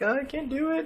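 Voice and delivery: mock high panicky whine; freaking out. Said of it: "she's freaking out".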